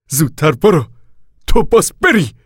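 loud, high, forceful order; commanding. A narrator's reading. Casey ordering Al to leave at once, commanding. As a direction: order him away at near-shouting pitch. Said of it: "با لحنی آمرانه گفت".

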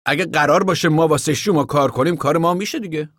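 lightly voiced Floyd. A narrator's reading. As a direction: Floyd holds his ground.

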